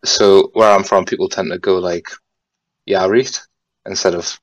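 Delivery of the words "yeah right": Geordie accent, "reet" with a long ee; a Geordie "Yeah, reet"; Geordie greeting. Shown as a demonstration. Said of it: "but in my weird accent".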